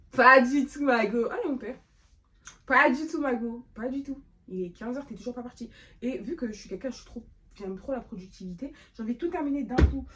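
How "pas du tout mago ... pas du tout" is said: teasing denial. Pretend. Mocking her own gym plan.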